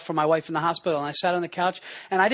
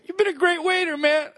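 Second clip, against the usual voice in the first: high, hammed-up tearful praise; faking tears; mock choked up; 10 semitones higher than usual. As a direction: pretend to well up with emotion. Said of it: "he gets a little emotional".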